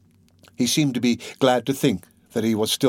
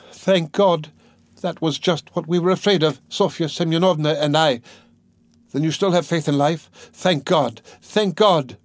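high-voiced, urgently relieved sister; relieved, emphatic, high woman's voice; fervent relief; 6 semitones higher than usual. A narrator's reading. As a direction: pour out thankful relief in a raised pitch. Sister relieved brother is alive.

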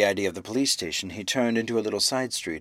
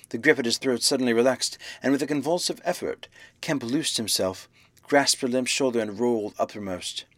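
ordinary delivery, nothing marked

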